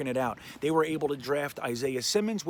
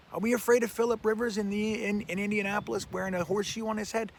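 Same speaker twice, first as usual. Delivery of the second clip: higher-pitched, scoffing rhetorical question; mocking disbelief; 6 semitones higher, faster than usual. Real, not acted. Fan scoffing at fear of Phillip Rivers, mocking.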